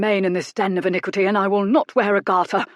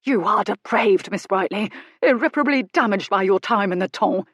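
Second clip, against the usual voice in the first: outraged tirade; 3 semitones higher, 4 dB louder than usual. A narrator's reading. Goodkind denouncing Miss Brightly as depraved, indignant and loud. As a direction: rail at her like a scandalised prude.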